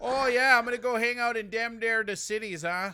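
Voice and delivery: loud, hammed-up northern Minnesota accent; imitating northern Minnesota accent. Host mocks his Hibbing relatives with a loud Minnesota accent. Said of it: "they always refer to the Minneapolis area".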